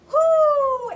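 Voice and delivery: loud, high hooting call; owl hoot. Acted. Reading to child, the outraged owl hoots loudly. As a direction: holler it like an angry owl. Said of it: "who hollered".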